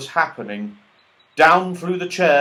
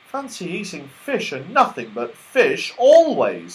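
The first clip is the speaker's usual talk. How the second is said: disgusted; 6 semitones higher, 5 dB louder than usual. Acted. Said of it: "making a face".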